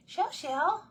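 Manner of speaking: high, loud pet-calling voice; little pet voice. Real, not acted. Owner calling the cat, little pet voice. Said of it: "Do y'all use your little voices".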